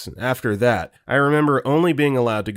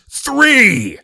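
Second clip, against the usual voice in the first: loud high final count; shouted count; 14 semitones higher, 9 dB louder than usual. Acted. Mugger counting down at Ted, loud shout. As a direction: bark the last number as a threat.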